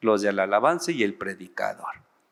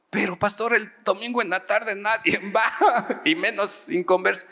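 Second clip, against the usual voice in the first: whiny protest; 10 semitones higher than usual. Retold or imitated. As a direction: plead your way out with a laugh. Acts his protest to the pastor. (protesta aguda y quejosa, entre risas)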